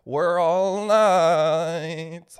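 loud, long drawn-out declaration; hammed-up knightly proclamation. Knights at their meeting, hammed-up loud proclamation. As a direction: stretch it out and belt it. Imagined knights announcing themselves.

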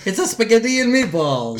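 mock Italian accent, pitched high and bouncy; Mario Italian accent. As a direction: play the Italian plumber from the video game. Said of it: "I'm gonna do it".

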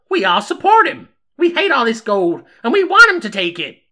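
very high, loud, eccentric character voice; high-pitched and loud, owning up to being nuts; nutty undertaker. Acted. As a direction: play a crazed townswoman, pitched right up high.

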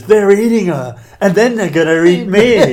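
high, loud hammed-up film scream; overacted bad-movie panic; mock panicked yell. Quoting Troll Two to a friend, loud mock panic. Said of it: "surely the ultimate one is Troll Two".

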